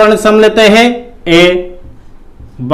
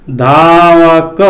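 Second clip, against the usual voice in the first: धावक drawn out slowly, syllable by syllable; slow sounding out; 3 semitones lower, much slower than usual. Shown as a demonstration. Teacher sounding out धावक slowly for students. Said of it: "किसकी ध्वनि आ रही है?".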